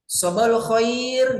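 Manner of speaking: "Sobal khair" long and sung out, falling on khair; sapaan ustazah. Shown as a demonstration. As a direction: greet the class with a slowly stretched "Sobal khair". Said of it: "ustazahnya nanya gitu gini". (ustazah menyapa kelas, "Sobal khair" dipanjangkan)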